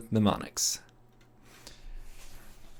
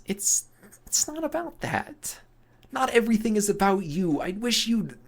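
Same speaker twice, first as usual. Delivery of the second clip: upset; 10 semitones higher than usual. Acted. Loxa hurt, pushing Emil away.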